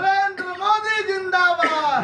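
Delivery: high, loud, slow slogan shout; booming political rally speech. Real, not acted. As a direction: lead the crowd in the chant. Leading crowd slogan chant.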